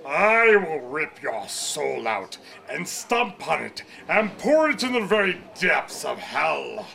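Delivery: high, slow, booming demonic threat; evil alter ego voice. Acted. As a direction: roar it like a crazed villain. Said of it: "He was like".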